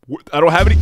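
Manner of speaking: normal talking voice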